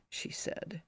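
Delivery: usual speaking voice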